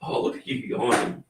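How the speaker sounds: normal talking voice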